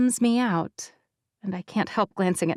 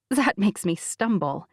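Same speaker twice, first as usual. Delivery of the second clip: ordinary delivery, nothing marked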